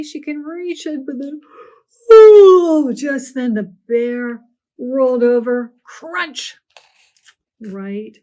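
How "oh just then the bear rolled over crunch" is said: sudden loud cry of alarm. A narrator's reading. Storyteller crying out in alarm. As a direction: cry out as the bear rolls over. Bear rolls onto kite.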